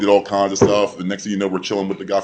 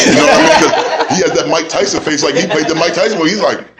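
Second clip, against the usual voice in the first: laughing; 8 semitones higher, 7 dB louder than usual. Real, not acted. Laughing while telling story.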